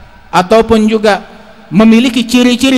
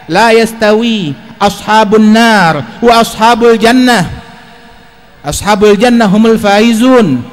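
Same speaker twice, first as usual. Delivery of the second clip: slow, emphatic Arabic recitation; forceful sermon declamation, measured and ringing; declamatory preaching; 4 dB louder, slower than usual. Acted. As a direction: proclaim the verse firmly, stretching the long vowels.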